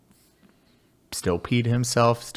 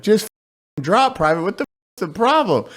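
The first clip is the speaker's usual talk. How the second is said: higher, louder mock drill sergeant shout; drill sergeant yelling; 12 semitones higher, 4 dB louder, faster than usual. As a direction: bark the order up at the hanging private. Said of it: "Drill sergeant's like".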